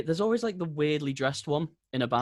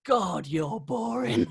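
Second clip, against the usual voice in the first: very slow, higher, weary sigh of a line; bored dismissal; 8 semitones higher, much slower than usual. Retold or imitated. Telling the heckler he bores him. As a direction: drawl it out, totally unimpressed. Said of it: "And I'm like".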